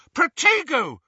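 high, loud spell cry. Acted. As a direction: cast it with a sharp cry. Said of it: "she cried".

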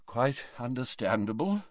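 put-on soft, faint old man; murmured. A narrator's reading. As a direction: murmur it weakly. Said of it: "murmured Dumbledore".

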